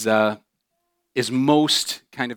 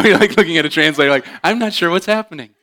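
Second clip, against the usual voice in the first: laughing; 6 semitones higher, 8 dB louder, faster than usual. Real, not acted.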